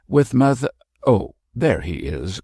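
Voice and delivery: a touch of alarm, called out; mild startled cry. Acted. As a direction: Mab spots the bishop leaving the tent, startled call. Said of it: "cried Mab".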